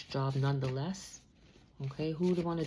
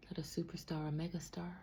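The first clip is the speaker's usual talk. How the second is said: whisper; 9 dB quieter than usual. Real, not acted. Whispering in sorrow about the lost superstar. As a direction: let it fade into a hushed whisper.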